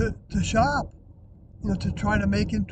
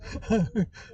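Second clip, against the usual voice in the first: short laugh. Real, not acted. Laughing at own silliness.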